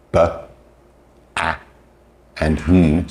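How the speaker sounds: each letter as its bare sound; phonics letter sounds. Shown as a demonstration. Teacher modelling phonics for learners, letter sounds. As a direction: sound out the letters one by one, separately. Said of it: "if you've learned the letters".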